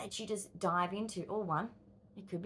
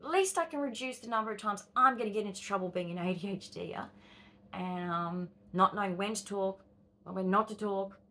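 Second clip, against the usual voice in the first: normal talking voice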